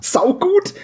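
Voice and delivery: high excited exclamation. Real, not acted. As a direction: pitch it way up with glee. Excited reaction.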